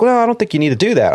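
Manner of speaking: high, fast brush-off; mock dismissive captain. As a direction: wave the idea away lightly. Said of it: "he was like".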